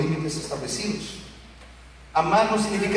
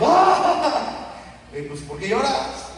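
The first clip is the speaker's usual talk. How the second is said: laughing mock surprise; 7 semitones higher, much slower than usual. Mocking a crybaby child.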